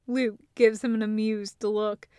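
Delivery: ordinary delivery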